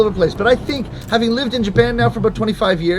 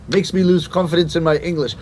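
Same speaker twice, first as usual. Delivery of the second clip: ordinary talk, nothing marked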